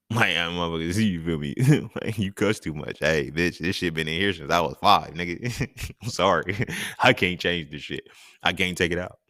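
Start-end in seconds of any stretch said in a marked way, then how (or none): 0.1-8.1 talking through real laughter; amused, breaking into laughs as you talk; laughing. Real, not acted. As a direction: laugh between phrases while owning up. Joking about own cussing, laughs.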